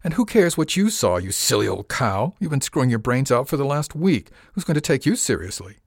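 angry sneer. A narrator's reading. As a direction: sneer at her with rising scorn. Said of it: "He sneered at her, his anger overwhelming".

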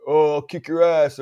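imitating a heckling fan; yelling fan. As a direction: toss it out like a fan in the stands. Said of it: "how many fans yell at players".

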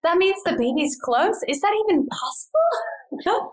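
rising high, slowing, astonished; can't believe it's already happening; amazed disbelief. Retold or imitated. Mother realising the baby is close, high and astonished.

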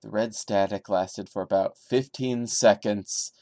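steady, subdued creepy-story reading; story narration. A narrator's reading. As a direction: read it straight like a campfire tale. Reads creepypasta story aloud.

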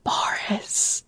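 breathy whispered aside; whispering. Real, not acted. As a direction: whisper the name like a secret. Whispered aside naming Boris.

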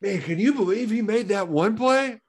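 lightly voiced excited dad; a hint of a dad after the game. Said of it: "your dad turns around".

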